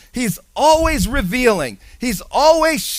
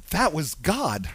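low, soft, reverent; hushed awe; 6 semitones lower, 9 dB quieter, slower than usual. Real, not acted. Pastor recalling God's hand, softly awed. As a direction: say it as a quiet revelation. Pastor's own realization, repeated.

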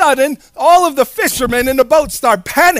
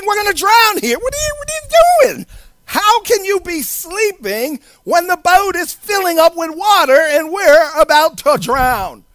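high, frantic pleading; panicked disciples; 8 semitones higher than usual. Acted. Disciples waking Jesus as the boat fills, panicked pleading. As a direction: act out an alarmed, indignant plea.

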